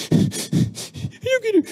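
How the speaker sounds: put-on very high, slow drawn-out child's whine; whiny child. Father imitating his son whining after losing, high whine. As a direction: whine it like a toddler who lost. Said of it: "y ya empieza".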